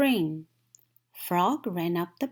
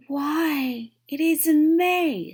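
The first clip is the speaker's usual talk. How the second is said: high, loud realisation; amazed; 9 semitones higher, 8 dB louder, slower than usual. A narrator's reading. Toad seeing the May page on top, amazed and loud. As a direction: marvel at the calendar.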